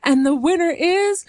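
hammed-up announcer building to a shout; mock announcer. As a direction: announce the winner like a show host, building. [name] announces the contest winner.

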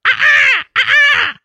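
very high, loud crow caws; shrill screeching bird. Pretend. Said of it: "sounds like an angry monkey".

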